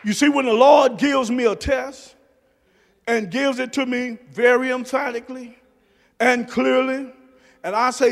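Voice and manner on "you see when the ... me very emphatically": usual speaking voice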